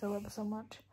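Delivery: usual speaking voice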